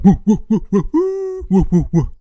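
high howling dog imitation. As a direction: woo like a talking dog. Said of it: "dog talking".

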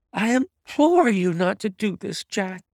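high imploring wife's voice; wife crying out. A narrator's reading. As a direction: beg him in a high, urgent wife's voice. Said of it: "she cried".

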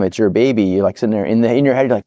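usual speaking voice

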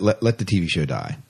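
ordinary delivery

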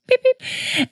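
very high squeaky beep-beep; mock beep sound effect. Shown as a demonstration. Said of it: "Here's the blooper sound effect".

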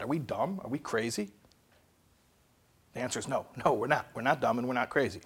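ordinary delivery

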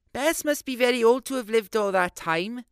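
put-on sweet young woman marvelling; young girl's voice.

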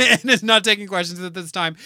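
giggling echo; laughing. Real, not acted. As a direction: laugh while repeating the line. Repeating it laughing.

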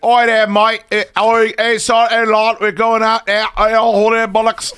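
high, loud, hammed-up Cockney babble. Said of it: "He's like".

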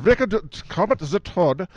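put-on high, loud stage-German accent; mock German accent. Co-host joking about a frog puppet's name, mock German accent. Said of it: "We have to do the German accents".

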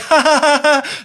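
loud, high fake ha-ha laugh; hammed-up imitation of nonstop laughing; mock laughter. Critic mocking film lovers who keep laughing, loud fake laughter. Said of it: "they just keep laughing".

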